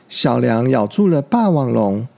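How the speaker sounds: plain reading voice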